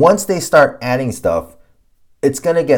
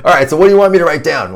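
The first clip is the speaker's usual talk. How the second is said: quick, louder client voice, mockingly casual; 5 semitones higher, 7 dB louder, much faster than usual. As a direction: rattle it off fast like a clueless client. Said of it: "they're like".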